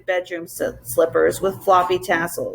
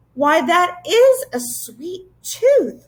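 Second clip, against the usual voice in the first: put-on a much higher, slow, wondering girl's exclamation; surprised; 10 semitones higher, 4 dB louder, slower than usual. A narrator's reading. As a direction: marvel at the tiny daisy on the tooth. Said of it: "Lindy said in surprise".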